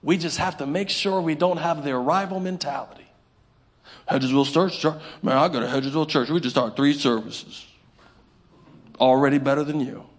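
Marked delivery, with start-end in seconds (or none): 4.1-10.1 mock bragging churchgoer.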